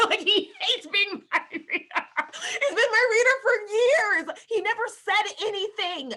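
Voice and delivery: high, loud, laughing outburst; laughing disbelief. Real, not acted. Wife telling of husband's relief, laughing incredulously. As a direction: squeal it through real laughter.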